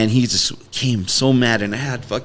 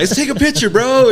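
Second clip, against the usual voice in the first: much higher, eager buddy voice; higher mock-friendly star; 13 semitones higher, 4 dB louder than usual. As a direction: gush like a newly friendly celebrity. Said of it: "He was like".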